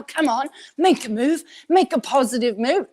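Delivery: pushy coach. Retold or imitated. Mocking old personal development to guest, lightly acted pushy urging. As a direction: urge someone onward like a pushy motivator.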